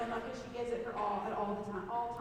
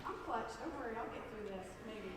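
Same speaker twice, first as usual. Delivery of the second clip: much softer, holding back tears; choked up; 7 dB quieter than usual. Real, not acted. Coach holding back tears during his speech, choked up. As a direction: fight through the emotion. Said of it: "This is emotional".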